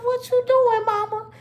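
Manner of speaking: put-on very high, squeaky child voice; mock panicked child. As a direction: squeal it like a worried kid. Scared child questions her mother.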